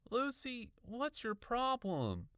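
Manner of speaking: put-on high, slow boy's voice, unbothered; muttering boy. A narrator's reading. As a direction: dismiss her in a high drawl. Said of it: "Aaron muttered".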